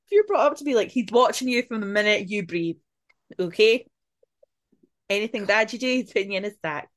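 high and loud, tickled by it; laughing. Real, not acted. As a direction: exclaim it with real amusement. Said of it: "I'm laughing".

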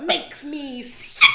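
slow, loud, drawn-out line delivery; camp quote. Retold or imitated. Quoting a favourite musical line, slow and loud. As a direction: savour every word of the quote. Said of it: "I love it's like".

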